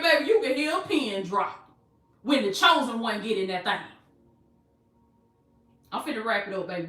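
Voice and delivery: normal talking voice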